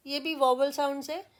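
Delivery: ordinary delivery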